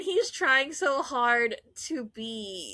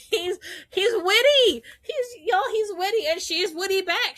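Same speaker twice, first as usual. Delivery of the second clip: much higher and louder, thrilled; excited gushing; 9 semitones higher, 4 dB louder than usual. Real, not acted. Fan gushing to viewers about the witty pair, excited and high. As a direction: gush about how witty they both are.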